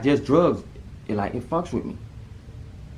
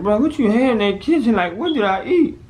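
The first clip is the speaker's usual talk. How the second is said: panicked; 10 semitones higher, 5 dB louder than usual. Retold or imitated. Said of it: "I'm like".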